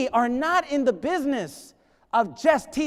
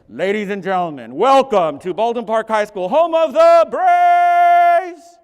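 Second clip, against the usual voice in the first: booming stadium announcer, stretched out; 11 dB louder than usual. Retold or imitated. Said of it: "someone that sounds like a D J".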